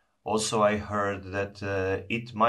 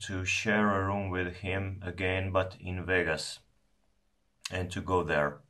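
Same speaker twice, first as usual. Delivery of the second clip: speaking with pipe in teeth; talking around pipe stem. Real, not acted. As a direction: pipe clenched in mouth corner. Seen 0: Pipe stem held at mouth corner while talking, hand supporting bowl.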